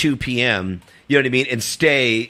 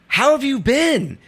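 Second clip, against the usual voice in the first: very high, slow, drawn-out delight; gushing greeting; 14 semitones higher, much slower than usual. Retold or imitated. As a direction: mimic someone thrilled at a reunion. Retold overjoyed greeting from mistaken actor.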